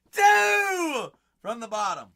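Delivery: high, loud, slow called-out pick; calling the pick for the stream; hype announce. Acted. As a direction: sing it out like a game-show host.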